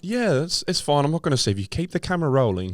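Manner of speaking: high, breezy mock-indifferent filmmaker; mock Louis. Louis calmly filming a man being mauled, high and breezy. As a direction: play the unbothered documentary maker. Voicing Louis watching the mauling.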